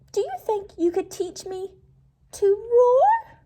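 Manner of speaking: high, squeaky polite voice; a tiny mouse working up courage; timid little mouse. Acted. Mouse waking the sleeping lion to ask for help, squeaky.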